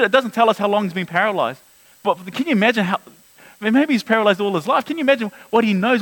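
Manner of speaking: ordinary talk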